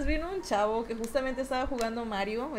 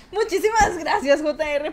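effusive thanks; 5 semitones higher, 7 dB louder than usual. Real, not acted.

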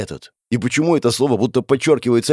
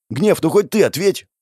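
exasperated appeal; 7 semitones higher than usual. A narrator's reading.

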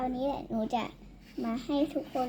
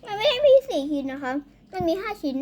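excited correction; 5 semitones higher, 5 dB louder than usual. Real, not acted. Child corrects the piece count.

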